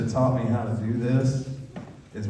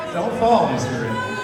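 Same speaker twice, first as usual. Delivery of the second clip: higher teasing call; 6 semitones higher, 8 dB louder than usual. Real, not acted. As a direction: call Don't fall out high and loud, sing-song. Repeats warning higher and louder.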